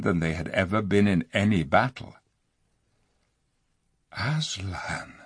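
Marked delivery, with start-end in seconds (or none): 4.1-5.1 soft, awed single name; very low voice. Acted. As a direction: breathe the name in dread. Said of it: "in a very low voice".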